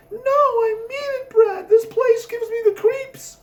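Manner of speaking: put-on high, slow, teary girl's voice; frightened whimper. A narrator's reading. Debbie begging Brad to leave the lovers' lane, high and teary. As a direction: whine it near tears, terrified.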